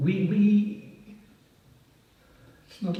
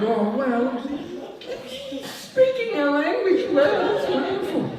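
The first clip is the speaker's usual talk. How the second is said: much higher, laughing delighted voice; delighted Poles. Retold or imitated. Poles delighted at a foreigner's Polish, gushing high and laughing. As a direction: gush like a flattered Pole. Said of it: "they think".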